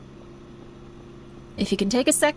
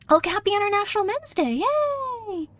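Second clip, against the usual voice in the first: 1 excited cheer; 10 semitones higher than usual. Real, not acted. Celebrates in-game holiday.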